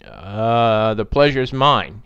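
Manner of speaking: drawn-out hesitant "uh", then a slow reply; slow, uncertain politeness; automatic, confused. Acted. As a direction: answer by rote while still puzzled. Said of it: "he said automatically".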